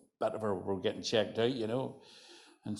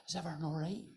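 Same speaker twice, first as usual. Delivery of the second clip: much quieter, gentle Larne lilt; softer Larne voice; 6 semitones higher, 9 dB quieter, faster than usual. Retold or imitated. A Larne woman checking on a visitor, softly. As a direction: soften it right down, kindly. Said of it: "it softens a wee bit".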